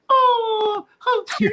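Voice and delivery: very high cooing "how cute"; cooing girls. Retold or imitated. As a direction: gush like a girl seeing something sweet.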